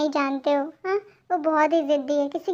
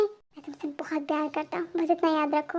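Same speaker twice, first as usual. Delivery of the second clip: much softer, tender and close; 6 dB quieter than usual. Acted. Chef reassures his crying girlfriend, tender soft words. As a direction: say it gently to comfort her.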